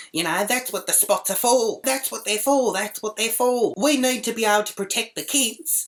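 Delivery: high-pitched mock ranting; high, insistent mimicked complaint; mouthing off. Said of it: "she's mouthing off at me".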